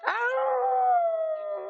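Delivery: high dog howl to get the dogs going. Shown as a demonstration. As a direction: throw your head back and howl.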